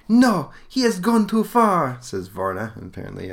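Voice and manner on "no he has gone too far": put-on high, loud, slow Russian-accented outburst; put-on Russian accent, indignant and drawn out. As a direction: protest as a Russian girl, pitched way up. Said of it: "I'm just giving her this accent".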